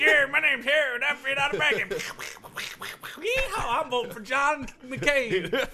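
bad Southern accent, pitched high; hammed-up high Southern yokel; mock a coworker in a terrible Southern twang; Harold. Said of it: "Harold's got a really bad Southern accent".

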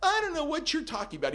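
high, fast, nervous denial. Retold or imitated. Stalker denying on the phone, high and fast. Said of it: "stalker denies on phone".

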